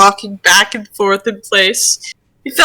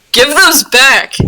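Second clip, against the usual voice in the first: loud, slow indignant yell; demanding shout; 5 semitones higher, 4 dB louder, slower than usual. Acted. Gil lunging at the spirit for his clothes, shouting. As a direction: lunge and demand them back.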